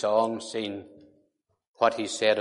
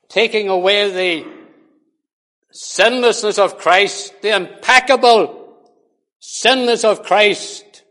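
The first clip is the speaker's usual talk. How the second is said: preaching at full force; 8 semitones higher, 8 dB louder than usual. Acted. Preacher to congregation, condemning the songwriter: full-force preaching. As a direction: thunder it out against the claim.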